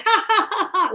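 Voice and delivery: high mock laugh like a kookaburra; bird laughing.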